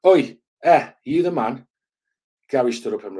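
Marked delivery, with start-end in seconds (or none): 0.1-1.7 put-on loud, high, aggressive heavy Scottish accent. Thug confronting the doorman, in a loud aggressive Scottish accent. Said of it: "in an ag-, aggressive, heavy accent".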